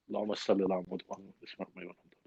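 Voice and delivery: fast, hushed whisper of the blessing; whispered prayer. Real, not acted. Host whispers the blessing before reciting Fatiha. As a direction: murmur the prayer under your breath, quickly.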